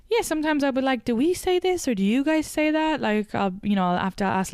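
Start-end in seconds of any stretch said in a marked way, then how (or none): 1.0-3.0 higher, puzzled asking voice; puzzled question. Retold or imitated. Wife asks husband which slang is American, puzzled. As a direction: check the slang with him, sounding unsure.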